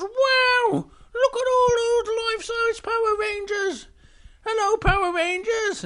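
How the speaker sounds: high, loud amazed little bear voice. Acted. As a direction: gasp in wonder like a small bear.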